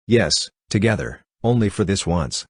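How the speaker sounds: ordinary delivery, nothing marked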